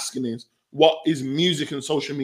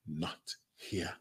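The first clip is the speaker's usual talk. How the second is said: much softer, low firm "not here"; mum's hushed rebuke; 12 semitones lower, 19 dB quieter than usual. Retold or imitated. Mum hushing her son at the visit, low and soft. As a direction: shush him quietly, mother to son in public. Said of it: "his mum said to him".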